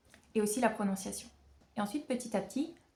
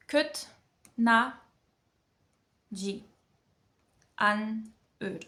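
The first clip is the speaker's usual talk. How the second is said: syllable by syllable; 4 dB louder, much faster than usual. Shown as a demonstration. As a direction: sound out each syllable separately, long pauses between. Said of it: "on apprend chaque syllabe".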